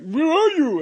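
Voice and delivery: a high mock echo of a friend; imitating Nicholas. Nicholas's voice echoed, much higher.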